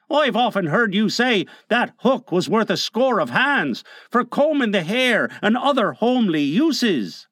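high, chatty henchman; pirate Smee. A narrator's reading. Smee reminds Hook of the hook's uses, high henchman voice.

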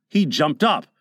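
plain reading voice

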